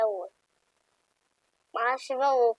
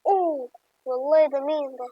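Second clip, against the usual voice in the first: usual speaking voice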